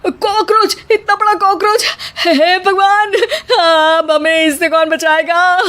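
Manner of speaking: high hammed-up alarm; mock panic. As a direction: fake a frightened cry. Son fakes alarm at cockroach.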